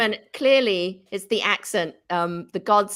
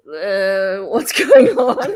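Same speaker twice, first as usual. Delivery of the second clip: imitating puzzled listener; 2 semitones lower, 8 dB louder, much slower than usual. Host imagines friend hearing message in Australia, mock bewildered. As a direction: act out someone baffled, breaking into laughter. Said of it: "He's probably sitting there going".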